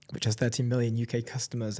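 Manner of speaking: ordinary talk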